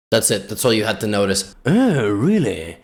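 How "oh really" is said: put-on slow, lower sophisticated British accent; posh mock-British musing; British engine. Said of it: "I don't know why the engine is British".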